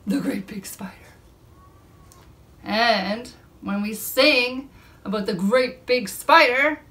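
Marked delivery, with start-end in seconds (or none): 0.1-0.9 low hushed whisper. Acted. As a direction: introduce him in a whisper. Whispered reveal of big spider.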